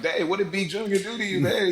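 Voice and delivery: mock exclaim. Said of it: "I was like".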